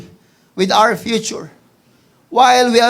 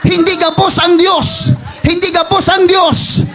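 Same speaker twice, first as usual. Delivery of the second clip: full-force preaching; 10 semitones higher, 8 dB louder than usual. Acted. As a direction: hammer each line home.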